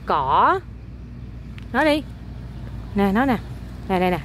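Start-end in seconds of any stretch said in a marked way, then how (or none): 0.1-0.6 "cỏ" stretched, dipping then rising; pronunciation demo. Shown as a demonstration. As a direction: say "cỏ" slowly for a child to copy, voice dipping and climbing. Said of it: "Con nói nè". (đọc mẫu "cỏ", kéo dài dấu hỏi)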